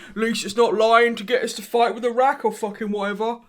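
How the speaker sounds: a hint of a put-on Yorkshire accent; lightly voiced Yorkshire local. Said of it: "that's my best Yorkshire accent".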